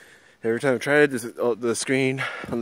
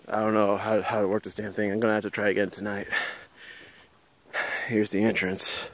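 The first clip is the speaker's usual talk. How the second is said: usual speaking voice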